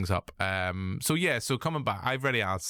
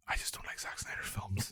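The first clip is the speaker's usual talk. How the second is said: whisper; 2 semitones lower, 11 dB quieter than usual. Real, not acted. Confessing a guilty opinion.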